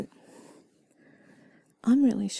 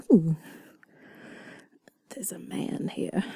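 put-on sultry southern accent; 4 semitones lower than usual. Voicing her own body on alert at a man nearby, sultry southern accent. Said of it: "speak in a sultry southern accent".

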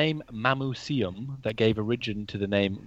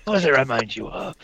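pirate voice; 10 semitones higher than usual. Pretend. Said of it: "Why do you sound like a pirate?".

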